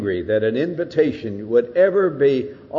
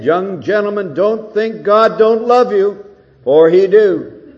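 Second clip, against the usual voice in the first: louder, higher preacher's appeal; Moody repeating; 8 semitones higher, 8 dB louder than usual. Retold or imitated. Moody pleading with the young men, loud and high. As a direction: drive the ungrammatical line home with force. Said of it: "said it again".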